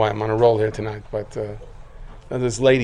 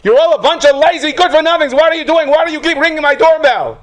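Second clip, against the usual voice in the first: put-on much higher and louder, roaring at the boy; furious yell; 9 dB louder than usual. Angry man yelling at the boy collecting at his door, furious shout. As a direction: blast it like an enraged homeowner. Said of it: "he yells at him".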